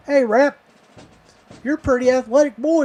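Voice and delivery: high-pitched imitation of a head drill sergeant; drill sergeant impression. Head drill sergeant greets young recruit called into his office, imitated high-pitched. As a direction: play the sergeant calling out, pitched well up. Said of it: "head drill sergeant calls me in".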